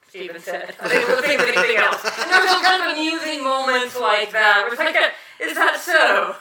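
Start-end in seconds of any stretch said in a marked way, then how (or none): 5.4-6.3 sarcastic. Retold or imitated. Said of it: "some sort of amusing sarcasm".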